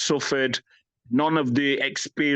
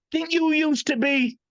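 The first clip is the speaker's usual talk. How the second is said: a much higher, teasing voice for the fans; mock fan voice; 12 semitones higher, slower than usual. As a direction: pitch it way up, mocking the people who ask. Quoting people who meet him.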